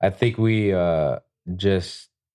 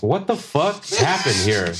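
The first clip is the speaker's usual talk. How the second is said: mock outraged surprise; 8 semitones higher, slower than usual. Finds Randy tattooing his client.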